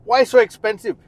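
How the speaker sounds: hammed-up outrage at the price tag; mock shock. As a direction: play the stunned buyer balking at the price. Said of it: "your mouth is opening and going".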